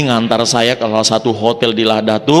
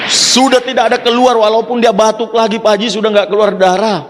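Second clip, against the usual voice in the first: overjoyed caller; 7 semitones higher than usual. Retold or imitated. Caller reports healing excitedly.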